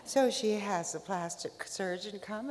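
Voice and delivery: ordinary delivery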